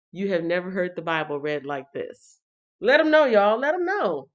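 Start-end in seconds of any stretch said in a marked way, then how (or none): 2.8-4.3 high, loud and giddy; hyper. Acted. Urging the viewers to spread the word, hyper and loud. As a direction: whoop it up like a hyped-up friend. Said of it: "she's a little bit hyper".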